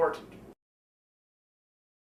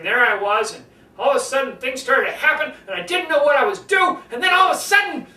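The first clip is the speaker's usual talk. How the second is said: from usual to higher and louder, climbing; climbing higher and louder into the story's peak; building up; 5 semitones higher, 5 dB louder than usual. Shown as a demonstration. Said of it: "You just start building".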